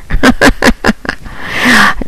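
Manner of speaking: airy laugh after the taunt; a short scornful laugh; mocking breathy laugh. Real, not acted. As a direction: laugh at him, all breath. Laughs after her own taunt.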